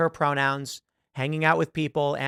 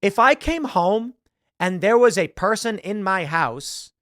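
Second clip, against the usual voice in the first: ordinary delivery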